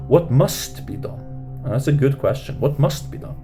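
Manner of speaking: ordinary talk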